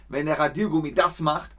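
ordinary talk, nothing marked

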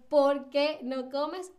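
"por qué" slow and split, stress on "qué"; pregunta con por qué. Shown as a demonstration. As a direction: ask it deliberately, "qué" emphatic, rising end. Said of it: "fíjense en cómo estoy pronunciando". ("por qué" separado, con fuerza en "qué")

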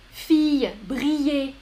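fille and briller with a Y glide; ILL as Y. Shown as a demonstration. Teacher gives regular examples, fille and briller with Y. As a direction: say fille "fee-y", briller "bri-yé". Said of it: "quand on a I".

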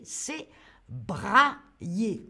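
usual speaking voice

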